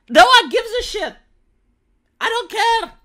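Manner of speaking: suddenly loud and high, fed up; exasperated outburst. Real, not acted. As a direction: blurt it out in exasperation. Ranting about institutions needing approval.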